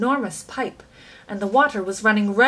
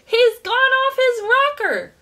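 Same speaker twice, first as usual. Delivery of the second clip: much higher, louder, slow alarmed cry; aghast shout; 13 semitones higher, 5 dB louder than usual. A narrator's reading. Frightened father in the dark tunnel, aghast shout. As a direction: yell it in horror. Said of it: "shouted one of the fathers, aghast".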